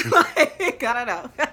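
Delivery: loud, high giggle over the words; real laughter breaking through; laughing. Real, not acted. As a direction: laugh through it, embarrassed at yourself. Laughing at her own reasoning.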